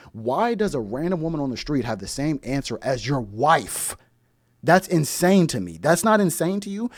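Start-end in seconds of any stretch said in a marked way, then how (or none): 4.6-6.9 louder, higher disbelief; indignant, raised voice; outraged. Real, not acted. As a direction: push the outrage up. Outraged rhetorical rant.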